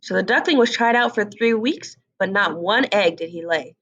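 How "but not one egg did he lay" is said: ordinary delivery, nothing marked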